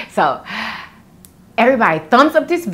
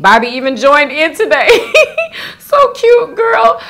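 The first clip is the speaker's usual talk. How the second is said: laughing delight; 8 semitones higher, 5 dB louder than usual. Real, not acted. Delighted by comment.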